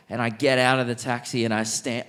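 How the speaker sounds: usual speaking voice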